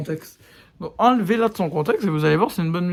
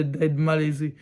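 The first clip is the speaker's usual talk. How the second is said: ordinary talk, nothing marked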